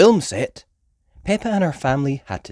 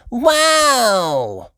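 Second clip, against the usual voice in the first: high, loud wow; excited cry; 13 semitones higher, 6 dB louder than usual. A narrator's reading. Peppa in the helicopter over the canyon, amazed. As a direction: gasp it out in wonder. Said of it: "cried Peppa".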